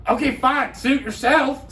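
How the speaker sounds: high-pitched imitation of her offended retort; mock huffy dismissal. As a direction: give up in a huff as the stranger. Retold lady huffily giving up.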